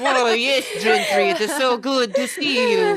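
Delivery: mock Russian accent, laughing; hammed-up Russian greeting. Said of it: "do that with a Russian accent".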